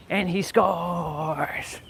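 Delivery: slow, hushed whispered call; slow whispered announcer hype; dramatic whisper. Pretend. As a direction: whisper the score like a tense commentator. Mock sports commentary.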